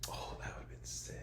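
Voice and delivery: hushed excited whisper. Real, not acted. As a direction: breathe it out as a whisper. Whispered reaction to joke.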